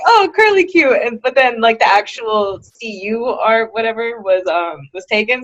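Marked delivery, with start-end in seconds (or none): 0.1-0.9 excited idea. Retold or imitated. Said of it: "so I was like".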